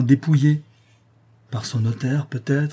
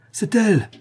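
an overjoyed outcry; excited cry; 10 semitones higher than usual. A narrator's reading. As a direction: cry it out in sudden joy. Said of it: "s'écria monsieur de Saint-Rémy".

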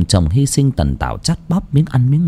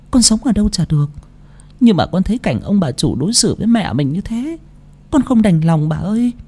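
put-on high, softer young maid's voice; devoted maid Nhàn, earnest; 7 semitones higher, 3 dB quieter than usual. Orphaned maid reassuring the old woman, earnest and tender. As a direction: gently plead in a higher, softer voice.

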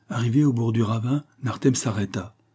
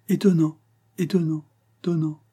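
fading echo; 8 semitones higher than usual. A narrator's reading. Hidden child echoes the amazed remark, fading away. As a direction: bounce it back, dying away.

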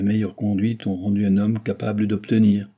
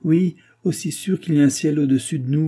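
higher, heated oath; angry and shaken; 6 semitones higher than usual. A narrator's reading. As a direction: swear it with trembling anger. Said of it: "tremblait d'émotion et de colère".

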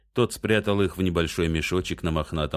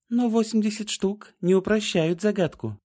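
put-on young woman voice. A narrator's reading. Trian's high voice.

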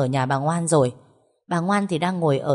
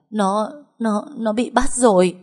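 high, choked stammer; stammering distress; 5 semitones higher, 3 dB louder than usual. A narrator's reading. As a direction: stumble over the words in panic. Sobbing mother stammers news. (lắp bắp hoảng hốt, giọng cao)

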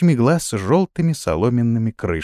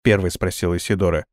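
ordinary delivery

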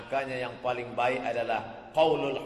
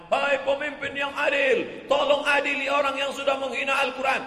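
crowd's demand; 11 semitones higher, 6 dB louder than usual. Retold or imitated. Crowd demanding justice from leader.

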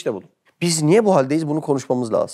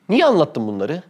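ordinary delivery

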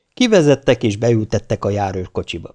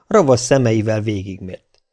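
ordinary delivery

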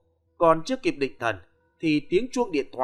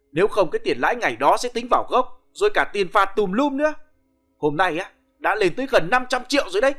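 high, loud, fast exasperated rant; exasperated lament; 5 semitones higher, 4 dB louder, faster than usual. Acted. Nam ranting in exasperation about the daily-interest loan. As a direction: berate them for not reading. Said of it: "Nam than vãn".

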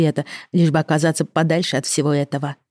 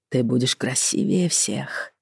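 tender husband; 3 semitones lower, 6 dB quieter, slower than usual. Acted. Husband tender, soft and slow.

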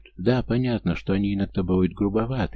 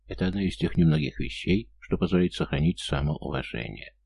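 ordinary delivery

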